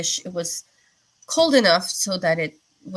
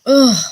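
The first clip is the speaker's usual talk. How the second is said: loud, higher frustrated "ugh"; a sudden groan, much louder and higher; 6 semitones higher, 13 dB louder than usual. Real, not acted. Frustrated groan about cold.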